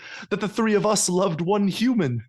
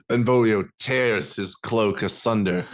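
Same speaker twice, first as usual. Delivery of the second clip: ordinary talk, nothing marked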